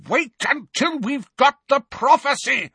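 very high, loud bellow; bawled. A narrator's reading. Malfoy bawling at Bellatrix, very high loud. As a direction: bellow over her. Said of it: "bawled Malfoy".

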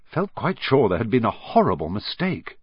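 ordinary delivery, nothing marked

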